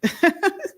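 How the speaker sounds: high laugh. Real, not acted. Stunned by the therapist clip.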